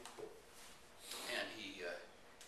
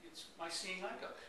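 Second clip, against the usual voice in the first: feigning blindness; 5 semitones higher, 4 dB quieter than usual. Acted. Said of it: "stare out into space and say".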